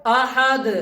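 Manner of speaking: अहद with a bare, released d at the end; stop on daal. Shown as a demonstration. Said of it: "जब दाल की voice निकली है". (अहद के आख़िर में सिर्फ़ दाल की आवाज़)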